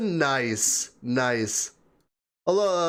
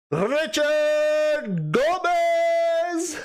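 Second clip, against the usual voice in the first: drawn-out swoon; 12 semitones higher, much slower than usual. Shown as a demonstration. Streamer swooning as she repeats a viewer's name. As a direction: swoon over the name, long and high.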